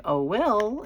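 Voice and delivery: high call rising at the end; sing-song call. Shown as a demonstration. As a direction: call her son again, lilting up. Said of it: "I have a way of calling him".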